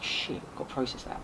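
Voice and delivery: hushed, breathless whisper; shocked whisper. Retold or imitated. Retold shock at manager's death.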